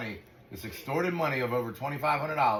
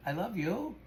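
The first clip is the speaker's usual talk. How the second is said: cooing to dog; 7 semitones higher, 4 dB quieter, slower than usual. Real, not acted.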